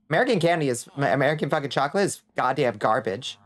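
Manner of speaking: ordinary talk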